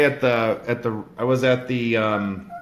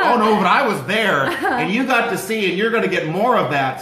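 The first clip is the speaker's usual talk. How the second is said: taunting; 5 semitones higher, 5 dB louder than usual. Retold or imitated. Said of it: "I try to, like, really mess with her".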